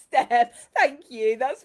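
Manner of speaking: loud, laughing through the thanks. Real, not acted. Host thanking Steph through loud laughter. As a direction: thank her while still laughing.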